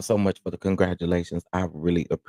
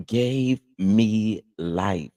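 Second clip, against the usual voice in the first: very slow emphasis; much slower than usual. Real, not acted. Recalling yesterday's live, very slow and savoured. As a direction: stretch every word very slowly. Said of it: "drawn out slowly".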